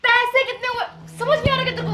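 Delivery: furious repeated demand, full shout; angry shout. Acted. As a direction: blow up and yell the question at him.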